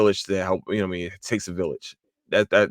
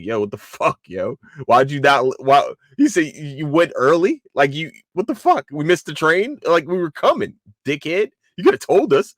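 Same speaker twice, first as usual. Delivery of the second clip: mildly acted indignant scolding, pitched up; angry little lieutenant; 6 semitones higher, 5 dB louder than usual. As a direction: imitate an irked lieutenant demanding answers. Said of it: "the little lieutenant that's angry".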